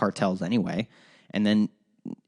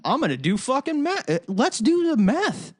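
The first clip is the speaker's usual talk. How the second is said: much higher, silly cartoonish voice, slowing on the second line; mock eager meth user; 13 semitones higher than usual. Mocking people who think legal drugs mean meth, silly high voice. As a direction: ham up an idiot thrilled that meth is legal. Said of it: "everyone would be like".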